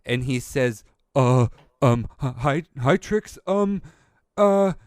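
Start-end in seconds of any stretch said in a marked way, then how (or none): 1.1-4.7 much higher, stuttering with hesitations; nervous hippo who can barely get the words out; scared shopkeeper stumbling over greetings; terrified stammer. Acted. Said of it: "He is terrified".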